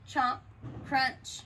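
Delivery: plain reading voice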